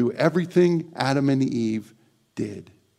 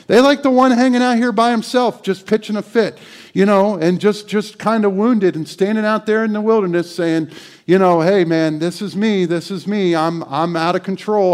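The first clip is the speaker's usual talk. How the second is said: loud, high climax of the sermon; preacher hitting the peak; fiery preaching; 6 semitones higher, 8 dB louder than usual. Acted. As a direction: build it up and shout it out. Preaching at full force.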